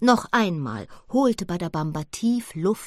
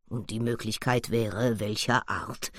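lower, measured gorilla voice; gorilla's voice; 7 semitones lower, 4 dB quieter than usual. Acted. Badabamba the gorilla asks. (Badabamba, tief und bedächtig)

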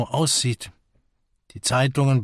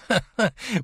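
short, higher-pitched acted chuckle; jovial laugh; 10 semitones higher than usual. A narrator's reading. Young guest jokes on arriving, jovial laugh. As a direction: laugh heartily as the cheerful guest.

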